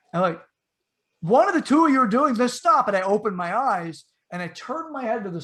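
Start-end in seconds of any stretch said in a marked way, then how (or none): 1.2-2.9 higher, louder exasperated shout; frustrated yell. Retold or imitated. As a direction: yell at them to cut it out. Yelling at friends, frustrated.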